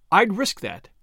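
mildly cheerful retort; a touch of breezy confidence. A narrator's reading. Gunwoman answering her captive's protest, mildly cheerful. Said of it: "said Missus Vandermeyer cheerfully".

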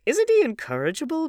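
very high, loud exclamation; crying out. A narrator's reading. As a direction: appeal to the table in amused outrage. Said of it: "cried Dorian".

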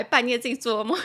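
ordinary delivery

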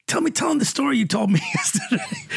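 high-pitched imitation, breaking into laughter; Whoopi Goldberg. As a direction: egg him on in her voice, laughing. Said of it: "She goes".

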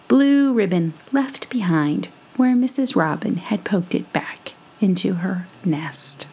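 usual speaking voice

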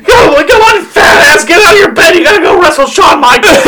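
hammed-up high wake-up yell; mock shout. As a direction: bellow at him to get out of bed. Mock waking a wrestler up.

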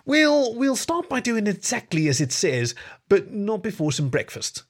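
high-pitched, hesitant grumble; grumbling. A narrator's reading. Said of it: "he grumbled".

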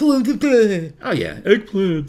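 high, loud dopey heckler; dumb heckler voice. Acted. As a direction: yell out a dumb suggestion. Idiot shouting suggestion.

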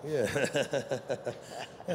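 usual speaking voice

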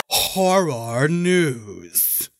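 very slow, stretched-out title call; drawn-out announcement. Pretend. Podcaster calls out the show title, drawn out. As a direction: stretch each word right out, with swooping pitch.

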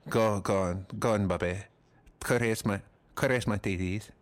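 put-on Spanish accent, halting; Penelope Cruz. Imagined star urging the married comic, Spanish accent. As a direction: urge him on in a mock Spanish accent. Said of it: "That's my Spanish accent".

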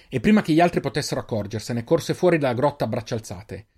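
plain reading voice

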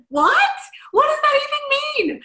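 loud, high-pitched disbelief; re-enacted bewilderment, voice shooting up; baffled outburst. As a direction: exclaim it in loud confusion. Retelling own baffled reaction to sister.

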